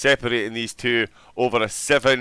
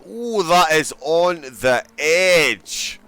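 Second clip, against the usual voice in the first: drawn out and slow, breathless with awe; slow awed gasp; 3 semitones higher, 4 dB louder, much slower than usual. Real, not acted. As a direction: savour each word as the car skims the edge. Driver pushing the track limits.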